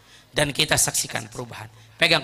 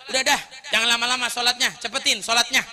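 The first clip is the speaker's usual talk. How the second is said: impatient, higher-pitched nagging; angry hurry-up; 8 semitones higher than usual. Retold or imitated. Impatient man telling the praying Ali to hurry up, angry. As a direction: snap at him to finish his prayer. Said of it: "Marah, Pak".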